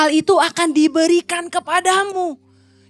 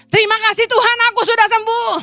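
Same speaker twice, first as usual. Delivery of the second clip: higher, louder proclamation of healing; bold declaration of faith; 7 semitones higher, 4 dB louder, faster than usual. Shown as a demonstration. Preacher shows the church how to speak healing in faith, loud and higher. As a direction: declare it out loud like it's already done. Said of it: "perkatakan dengan iman".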